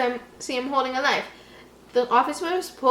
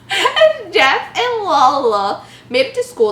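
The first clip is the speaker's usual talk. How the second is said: slow, louder, high laughing read; 7 semitones higher, 7 dB louder, slower than usual. Real, not acted. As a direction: read it while cracking up. Reader still laughing.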